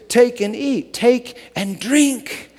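loud, high, ringing proclamation; slow, lifted sermon voice; preaching. Acted. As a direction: proclaim Christ's words out to the church.

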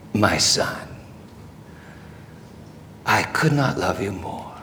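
very soft, low voice; tender fatherly love. Acted. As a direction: hush it right down, pausing between phrases. The Father speaks lovingly.

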